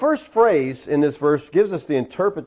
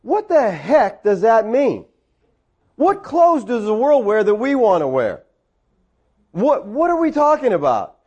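baffled, raised-pitch questioning; incredulous; 4 semitones higher than usual. Acted. Teacher puzzling over a commentator's quote, incredulous. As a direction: demand to know what on earth it means.

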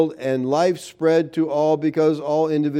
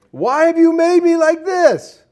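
high, slow, whining and louder; whiny clay pot; 13 semitones higher, 6 dB louder than usual. Acted. Clay mocks the potter in a high, slow whine. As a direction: stretch out the clay's complaint. Clay talks back, repeated.

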